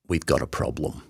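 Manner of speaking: low, soft, grave delivery; Jeff. Retold or imitated. Consultant breaking bad news in clinic, low and grave. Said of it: "Do my best Jeff impression".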